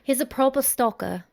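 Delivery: a light English accent on proper stalker. Shown as a demonstration. Cohost demonstrating an English accent, lightly put on. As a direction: say it like a Londoner, lightly. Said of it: "how would you say that in English accent".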